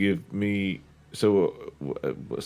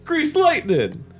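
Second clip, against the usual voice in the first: high, loud, excited cartoon shout; high excited Triad voice; 8 dB louder, slower than usual. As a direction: belt it out high like a goofy teen.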